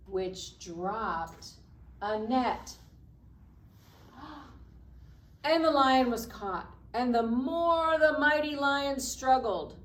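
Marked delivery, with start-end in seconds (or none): 4.0-5.4 sharp breathy gasp of alarm; startled gasp. A narrator's reading. Storyteller gasps as the net falls on the lion. 5.4-9.7 louder and higher, telling it urgently; dramatic struggle. A narrator's reading. Storyteller tells the trapped lion's struggle, loud and urgent.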